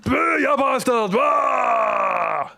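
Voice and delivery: high, slow shouted boo; startling boo. Pretend. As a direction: jump out and scare them. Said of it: "Did I scare you?".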